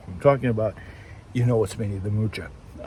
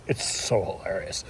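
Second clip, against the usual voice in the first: whispered aside; 4 semitones lower, 8 dB quieter than usual. Real, not acted. Amused aside.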